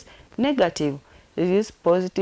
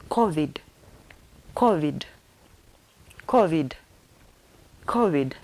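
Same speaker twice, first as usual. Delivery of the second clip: usual speaking voice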